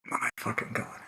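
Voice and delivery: exasperated whisper. Real, not acted. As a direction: breathe it out under the breath.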